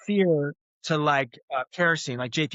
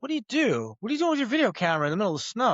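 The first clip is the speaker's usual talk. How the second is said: lightly voiced neighbour, higher and fast; quizzical homeowner; 7 semitones higher, faster than usual. A hint of the neighbour quizzing him in the snow. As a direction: rattle off a curious stranger's questions. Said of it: "he's like".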